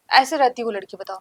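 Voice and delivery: ordinary delivery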